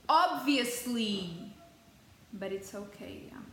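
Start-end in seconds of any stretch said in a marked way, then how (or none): 0.1-1.5 sarcastic drawl. Real, not acted. Sarcastic about bet.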